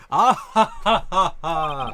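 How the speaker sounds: loud booming hero laugh; All Might laugh. Retold or imitated. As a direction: boom out a heroic laugh. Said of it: "Do an All Might impression".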